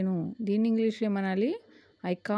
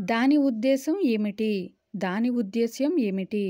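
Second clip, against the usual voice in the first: ordinary talk, nothing marked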